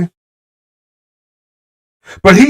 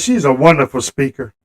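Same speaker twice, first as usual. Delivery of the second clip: sincere aside; 8 semitones lower, 6 dB quieter than usual. Real, not acted. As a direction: lower and much softer, heartfelt. Sincere aside praising Michelle Obama.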